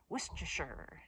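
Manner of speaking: "Worcestershire" squeezed short, falling; whoosh pronunciation. Shown as a demonstration. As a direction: swallow the end of "Worcestershire". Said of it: "put an emphasis on the whoosh".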